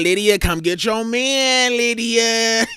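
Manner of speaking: hollering across the room for Lydia; slow, drawn-out holler at Lydia; mock calling out.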